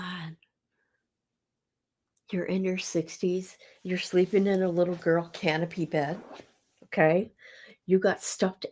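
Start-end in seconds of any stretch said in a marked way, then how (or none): none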